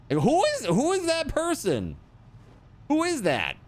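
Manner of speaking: high, disbelieving; incredulous. Real, not acted. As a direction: ask in total disbelief. Disbelief at camel jerky.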